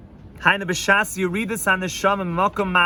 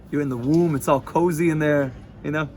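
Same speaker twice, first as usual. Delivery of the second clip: ordinary talk, nothing marked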